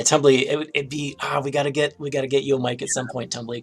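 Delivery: ordinary delivery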